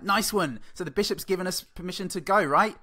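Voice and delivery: cocky knight voice. Pretend. Knights voiced mocking the bishop.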